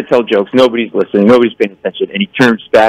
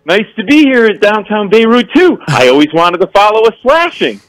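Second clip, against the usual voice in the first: higher-pitched stage patter; stage comic opener; 8 semitones higher than usual. Retold or imitated. Comic to the dive bar after a stabbing, stagey greeting. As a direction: open a set to a club crowd. Re-enacts his stage opening.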